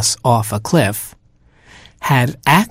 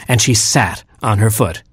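usual speaking voice

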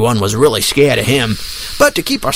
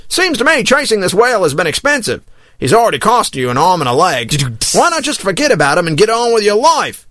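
lightly voiced, higher-pitched young sailor; questioning sailor; 6 semitones higher than usual. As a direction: politely challenge the captain, pitched up. Timid sailor questions the captain.

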